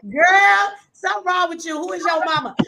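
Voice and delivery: high, squealing take on the rambling woman; mimicking her on stage. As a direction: act out how she goes in on stage. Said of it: "Let me show y'all".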